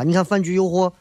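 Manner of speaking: ordinary talk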